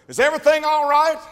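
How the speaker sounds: high, loud call from outside; calling through a door. Retold or imitated. Preacher calling in at the door while the women pray. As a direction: holler it into the next room.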